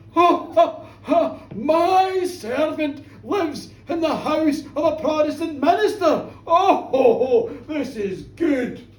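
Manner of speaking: loud, high, laughing proclamation; theatrical villain relishing the joke; gloating laughter. Acted. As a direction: crow with delight between big laughs. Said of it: "the tall figure laughed again".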